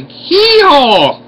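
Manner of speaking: high, loud hee-haw bray; donkey bray. Pretend. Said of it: "I sound like a certified hillbilly".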